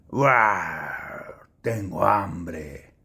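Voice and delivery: deep, slow monster growl; a big hungry monster, drawn out; friendly monster voice. Acted. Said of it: "dicen que dijo el monstruo".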